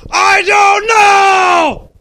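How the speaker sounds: high, strained full-volume yell; wounded father. A narrator's reading. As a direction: bellow slowly as a hammed-up dying father. Dying father answers son.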